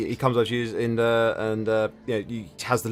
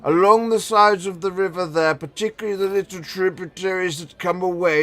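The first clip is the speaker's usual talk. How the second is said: put-on old man voice; 3 semitones higher than usual. GM continues old wizard NPC.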